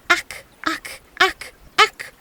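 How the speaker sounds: high, strange frog croak. A narrator's reading. Frogs crying at the peasant from the pond, high croaking. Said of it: "he heard the frogs crying".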